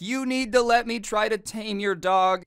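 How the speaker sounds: high, insistent mock demand; imitating a freakout. Kid freaking out at the dog owners, high mock demand. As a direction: whine it like a kid losing it. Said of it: "starts freaking out on them".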